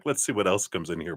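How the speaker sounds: normal talking voice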